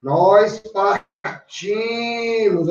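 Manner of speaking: "Nós partimos" stretched very slowly; slow teaching demo; slow stress demo. Shown as a demonstration. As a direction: draw out "partimos" to mark the stress. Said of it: "a risotônica".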